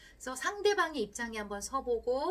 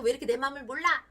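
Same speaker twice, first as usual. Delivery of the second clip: quick, louder annoyed snap at the husband; irritated outburst; 4 semitones higher, 7 dB louder, faster than usual. Retold or imitated. Wife snapping at her husband for not understanding, fast and irritated. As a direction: fire it off fast, fed up and sulky. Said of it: "막 화내고 짜증을 냈는데".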